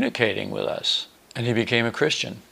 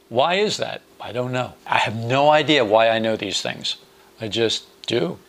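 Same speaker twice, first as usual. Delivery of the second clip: usual speaking voice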